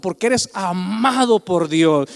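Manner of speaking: ordinary talk, nothing marked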